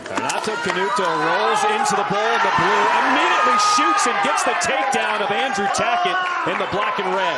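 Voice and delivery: booming fighting game announcer; excited play-by-play call. Real, not acted.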